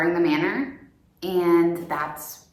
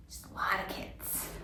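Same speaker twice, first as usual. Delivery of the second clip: unvoiced whisper, much softer; whispered aside; 16 dB quieter than usual. Real, not acted. Co-host's aside on the nine children, whispered. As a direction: whisper it as a quick side comment. Quiet aside during the history narration.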